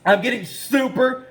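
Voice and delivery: slow, building excitement, stretched "super"; pumped up. Real, not acted. Trapper looking forward to setting traps, pumped up. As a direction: draw out each "super" with relish. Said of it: "super pumped".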